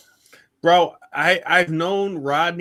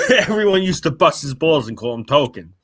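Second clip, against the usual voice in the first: laughing through the words; chuckling while telling it; 2 semitones higher, 7 dB louder than usual. Real, not acted. Retelling the joke, laughing.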